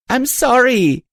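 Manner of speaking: high sobbing yell; tearful yell. A narrator's reading. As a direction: burst out crying the apology. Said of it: "I yelled back, bursting into tears".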